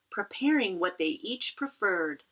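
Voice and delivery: ordinary delivery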